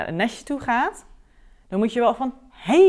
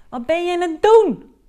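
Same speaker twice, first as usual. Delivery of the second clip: higher, louder disbelieving question; incredulous challenge; 9 semitones higher, 6 dB louder than usual. Real, not acted. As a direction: throw it at him in disbelief. Coach confronts client's sugarcoating.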